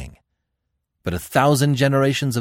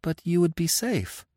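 high-pitched bewildered protest; puzzled, pitched high; 11 semitones higher than usual. A narrator's reading. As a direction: begin a baffled objection. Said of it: "she began bewilderedly".

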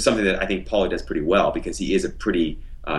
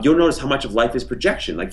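ordinary delivery

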